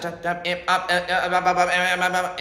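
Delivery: repeating stuttered syllables piling up; imitated glitching sounds. Shown as a demonstration. Showing sounds breaking down and cascading, imitated glitch stutter. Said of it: "So you hear sound".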